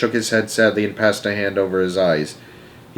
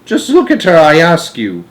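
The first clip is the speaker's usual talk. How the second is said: dismayed cry; 6 semitones higher, 6 dB louder than usual. A narrator's reading. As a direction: appeal to them loudly in dismay. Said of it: "cried Grandpa Joe".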